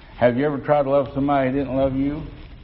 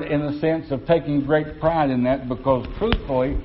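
normal talking voice

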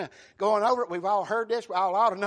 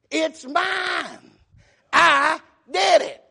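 "mine" drawn out high, then "I did it" slow and loud; emphatic confession; 5 semitones higher, 5 dB louder, much slower than usual. Acted. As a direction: own up with weight on "mine" and "I". Acts David's emphatic confession.

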